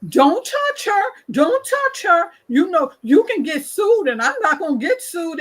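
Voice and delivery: mock alarmed shout of don't touch her; warning bystander.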